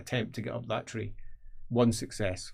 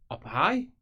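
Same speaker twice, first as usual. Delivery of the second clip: ordinary talk, nothing marked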